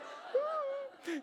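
high, tiny frightened hmm; nervous squeak. Retold or imitated. Caught little girl squeaks in fear at the owner.

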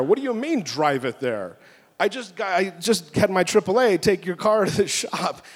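high, exasperated retold protest; worked-up mock complaint; aggravated. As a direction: act out indignant disbelief, pitch jumping up. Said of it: "I got a little aggro".

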